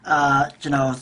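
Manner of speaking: ordinary delivery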